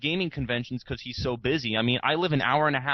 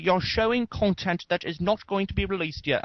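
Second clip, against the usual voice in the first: imitating an angry commenter in a put-on British accent, higher; hammed-up British accent, ranting and pitched up; mock British accent; 7 semitones higher than usual. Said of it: "not necessarily in the British accent".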